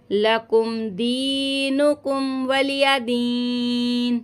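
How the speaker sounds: slow, melodious Quran recitation; full recitation. Shown as a demonstration. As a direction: recite the whole surah slowly, melodically. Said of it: "पूरी सूरत आपको पढ़ के सुना रही हूँ". (तिलावत का अंदाज़)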